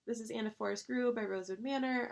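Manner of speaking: ordinary talk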